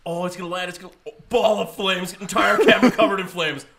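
rising into a loud excited yell; building hype over a crash; mock excited commentary. Mocking excited test-run commentary.

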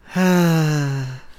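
long imitated sigh of defeat; mock-sulky sigh, slumped on the couch; soft, airy pretend sigh; dejected sigh. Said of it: "just sit on the couch and just go".

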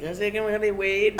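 high mock stoner question; imitating Legacy. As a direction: pitch way up, cheeky and eager. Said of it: "He's like".

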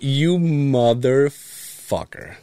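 slow, drawn-out betrayed curse; slow mock-betrayed groan at a friend's prank; mock outrage. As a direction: stretch it out at the friend who set you up. Friend set him up.

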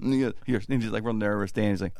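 ordinary talk, nothing marked

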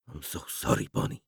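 soft, breathy whisper of sorrow; hushed, grieving whisper; whispered apology. A narrator's reading. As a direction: whisper it as if to yourself. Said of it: "Stan whispered".

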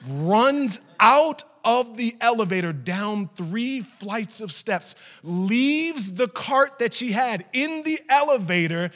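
drawn-out, rising, loud sermon storytelling; full-force preaching. Acted. Preacher dramatizing a mother's sprint downstairs, at full force.

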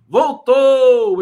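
loud, high "Voltou!"; excited shout. Real, not acted. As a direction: cheer that he's back. Guest's video comes back.

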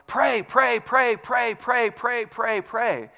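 insistent, evenly punched "pray" over and over; emphatic repetition. Retold or imitated. Preacher stressing the Bible's repeated call to pray, emphatic. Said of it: "makes this emphasis of".

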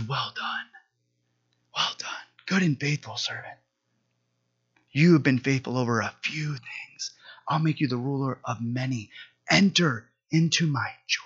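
soft, reverent whisper; hushed awe. Acted. God welcoming His servant before billions, hushed whisper.

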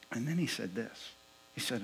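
ordinary talk, nothing marked

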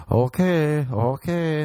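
a touch of rising panic, retold; mildly freaking. Trapped man told there's no lock, mild mounting panic.

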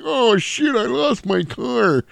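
very high, whiny dope; very high, slow dopey whine; Deputy Doofy. Retold or imitated. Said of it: "I'm talking like Deputy Doofy".

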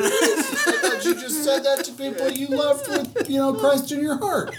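very high, laughing sweet voice; giggly sugary churchy innocence; mock sweet naive. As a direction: play dumb in a sugary high voice.

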